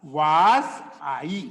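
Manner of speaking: "was" drawn long with an aa sound; long vowel was. Shown as a demonstration. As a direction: stretch "was" toward aa before I. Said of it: "इसी was को मैं ऐसे बोलूंगा".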